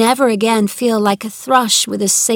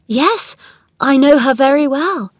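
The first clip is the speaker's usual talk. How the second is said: high, louder surprised reply; 7 semitones higher, 5 dB louder than usual. A narrator's reading. Mary learns Colin knows Martha, surprised. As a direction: answer with raised, surprised pitch.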